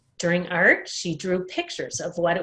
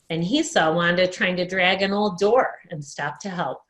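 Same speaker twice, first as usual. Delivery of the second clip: plain reading voice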